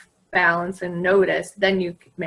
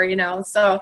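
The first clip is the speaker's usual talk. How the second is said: usual speaking voice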